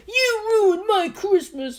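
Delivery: high, drawn-out wail imitating an irate customer; hammed-up yelling, words stretched out; mock angry yell. Irate shopper laying into a salesperson, mock yell. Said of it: "this guy was yelling at him".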